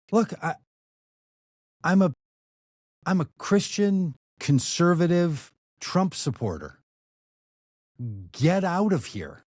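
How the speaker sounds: imitating an irate owner laying down the law; angry order. Owner declaring his politics and ordering them out, angry.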